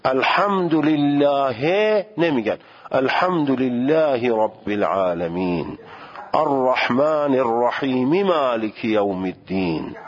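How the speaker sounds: slow Quranic recitation, ending vowels read out; recitation demo. Shown as a demonstration. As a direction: recite slowly, voicing each final vowel. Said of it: "حرف آخر رو میگم".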